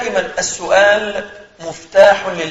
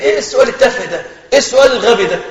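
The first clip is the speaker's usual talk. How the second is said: mock contemptuous teacher, higher and louder; scornful teacher; 5 semitones higher, 4 dB louder, faster than usual. Teacher scolding a curious student, mock contempt. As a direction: imitate a teacher sneering at a student's question. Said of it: "يقول".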